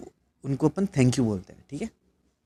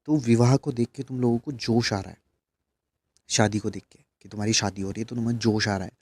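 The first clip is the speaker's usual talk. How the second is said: usual speaking voice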